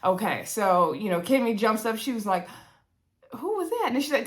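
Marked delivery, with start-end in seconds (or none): 3.4-3.8 high-pitched imitation of a surprised friend; startled question. Voicing Kimmy jumping up at arrival.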